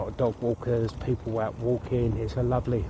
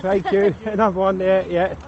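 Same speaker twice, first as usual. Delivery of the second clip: calling out; 7 semitones higher, 7 dB louder than usual. Real, not acted. Thanking walkers while cycling past.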